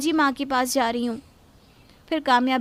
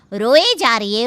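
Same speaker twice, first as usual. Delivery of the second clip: loud, exasperated रोए जा रही है; fed-up रोए stressed; annoyed complaint; 8 dB louder than usual. Acted. Wife complaining about crying mother-in-law, loud.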